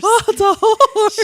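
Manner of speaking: high, loud, drawn-out swoon; mock horrified cry. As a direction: wail it slowly like a hammy fainter. Acts neighbor fainting at a horse.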